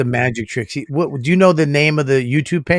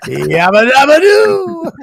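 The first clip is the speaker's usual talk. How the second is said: cartoon catchphrase; 8 semitones higher, 5 dB louder, slower than usual. Flintstone joke, cartoon catchphrase.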